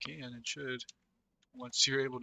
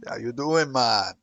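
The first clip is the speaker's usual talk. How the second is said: louder, laid-back mock Jamaican greeting; mock Jamaican accent; 3 semitones lower, 9 dB louder, slower than usual. Showing a friend how trolls greet, mock Jamaican accent. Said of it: "They are fucking Jamaican".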